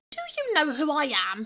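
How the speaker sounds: high, loud indignant whine; a pompous goblin ghost whining. Acted. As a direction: whine it like a spoiled little prince. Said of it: "he whined".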